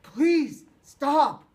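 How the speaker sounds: loud high plea; begging cry. Acted. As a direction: beg desperately in pain. Cole begging under kicks.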